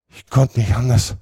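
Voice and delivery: höher, gequält gedehnt; stöhn es gequält; stöhnend. A narrator's reading. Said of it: "stöhnte Potter".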